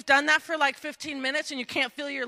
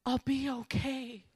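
quiet reassurance; 9 dB quieter than usual. Acted. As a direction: reassure gently, almost under the breath. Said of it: "as if to say".

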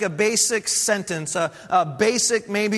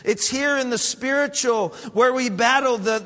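normal talking voice

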